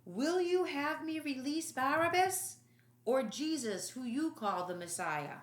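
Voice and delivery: loud, high call over the crowd. Acted. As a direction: call out to the mob. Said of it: "Pilate grabbed his arm and called out".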